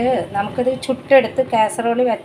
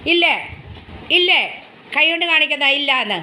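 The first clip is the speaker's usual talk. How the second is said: put-on high, sing-song, louder baby talk; high pet-talk voice; 5 semitones higher, 4 dB louder than usual. Owner playfully scolds her dog in high baby talk. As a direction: tease the dog like a small child.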